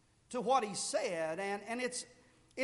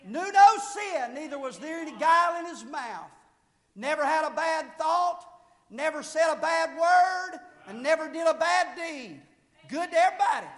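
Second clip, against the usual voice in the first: preaching at full force; 5 semitones higher, 10 dB louder than usual. Acted. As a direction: proclaim it to the whole church. Preaching climax on Christ's purity.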